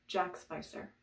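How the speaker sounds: usual speaking voice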